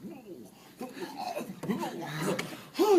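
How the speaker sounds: sharp high gasp surfacing; a big gulp of air like coming up from water. Retold or imitated. Coming up from the rapids, gasping for air.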